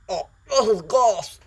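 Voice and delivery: high, loud choking outcry; Izuku. Acted. Boy as symbiote enters his throat, loud gagging cry. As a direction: blurt it as if something forces down your throat. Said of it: "and Izuku goes".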